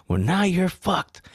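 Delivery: high, drawn-out mock yell; slow, high retold yell; cop yelling. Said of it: "the cop was yelling at him".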